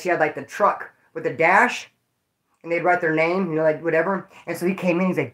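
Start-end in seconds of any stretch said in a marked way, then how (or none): none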